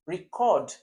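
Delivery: "record" stressed on the second syllable, re-CORD. Shown as a demonstration. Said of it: "I have verb here".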